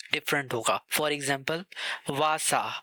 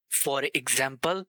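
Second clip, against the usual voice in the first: ordinary delivery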